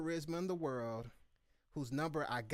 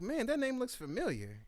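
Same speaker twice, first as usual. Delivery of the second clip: puzzled; 11 semitones higher, 4 dB louder than usual. Retold or imitated. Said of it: "I was like".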